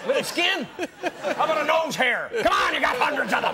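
very high, loud mock hollering; hammed-up screaming fanatic; frantic demanding fan. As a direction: shriek demands like a crazed souvenir hunter. Acted fan demanding souvenirs.

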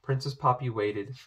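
usual speaking voice

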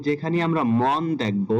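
ordinary talk, nothing marked

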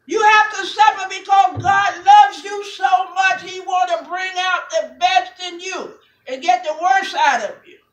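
loud, rising call to the congregation; full-force preaching. Acted. Pastor to congregation, shouting the sermon home.

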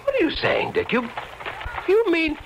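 high, incredulous questioning; astonished. Acted. Friend to Dick on seeing the girl, astonished. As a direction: question it in disbelief, pitch shooting up.

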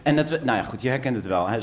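ordinary talk, nothing marked

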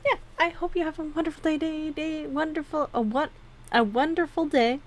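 sing-song. Real, not acted. Playful sing-song sign-off.

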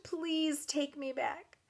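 high, slow tearful plea; drawn-out, desperate pleading; sobbing. A narrator's reading. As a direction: beg through sobs. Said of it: "I sobbed".

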